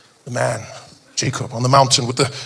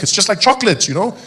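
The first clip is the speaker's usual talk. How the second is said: ordinary talk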